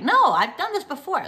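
breezy overconfident no, high and loud; jaunty, cocky dismissal. Retold or imitated. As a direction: brush off the worry, full of confidence. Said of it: "I was being all jaunty".

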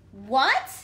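loud, rising what; indignant what. Acted. Pigeon answering the teacher's doubt, indignant. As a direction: act offended.

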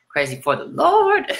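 high, loud revival-preacher yelp; hammed-up preacher. Joking to viewers in a mock preacher voice. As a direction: shout it like a tent-revival preacher.

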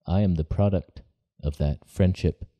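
ordinary talk, nothing marked

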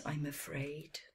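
softly whispered regret; regretful hush. A narrator's reading. Camel tells the calf no, in a sorry whisper. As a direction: let it fall to a whisper. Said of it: "Another camel replied".

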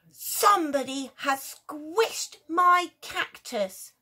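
loud, slow indignant dad; outraged dad. Acted. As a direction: boom it out, offended. Daddy person outraged.

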